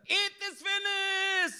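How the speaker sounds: high, loud, very slow proclamation; Jesus declaring victory, triumphant; triumphant declaration. Acted. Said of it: "Tuhan Yesus berkata".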